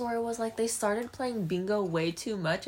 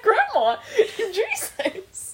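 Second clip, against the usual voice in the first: laughing through a high exasperated quote; cracking up while scolding grandma; 12 semitones higher, 5 dB louder, slower than usual. Retold or imitated. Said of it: "And I was like".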